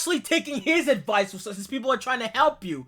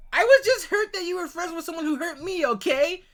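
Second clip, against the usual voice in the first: mocking his whiny message; 6 semitones higher than usual.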